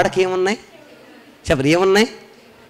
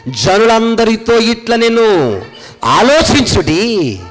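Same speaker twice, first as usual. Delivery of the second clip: slow, drawn-out verse recitation; scripture reading; 4 semitones higher, slower than usual. Acted. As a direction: stretch each phrase like a solemn proclamation. Reads a scripture verse aloud.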